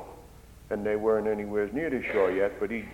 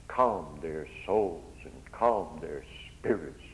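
lower, softer, slowed; soft and calm; 6 semitones lower, 5 dB quieter, slower than usual. Acted. Preacher tells how words calmed disciples, softly. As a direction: let the words settle like calm water.

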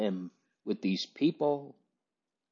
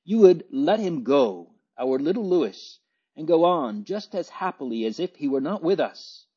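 savage outburst; 7 semitones higher than usual. A narrator's reading. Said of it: "turning almost savagely upon her".

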